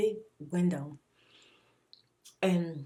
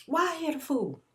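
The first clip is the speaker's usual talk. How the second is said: high, sharp angry question at her son; retold angry demand; 6 semitones higher than usual. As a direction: demand it like a fed-up mom. Yelling at son about the fool.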